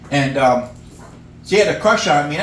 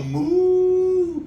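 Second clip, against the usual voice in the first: high happy moo; a bright cow moo pitched way up; 13 semitones higher than usual. Retold or imitated. Comic showing how a happy cow moos, pitched high. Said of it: "when they were happy".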